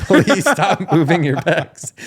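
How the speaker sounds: pleading through laughter, pitch up; laughing, half-begging friend; laughing plea. Retold or imitated. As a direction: beg him to stop while cracking up. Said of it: "you would have to verbally say".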